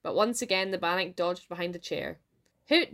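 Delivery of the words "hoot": short disdainful exclamation; disdainful cry. A narrator's reading. Wife scorning her husband's miss, disdainful cry. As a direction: scoff it out in mild disdain. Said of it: "Janet disdainfully cried".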